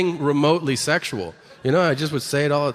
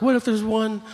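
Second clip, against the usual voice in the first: mock concern; 8 semitones higher than usual. Objectors warning someone might be nearby, high mock concern. Acts out worried objectors in argument.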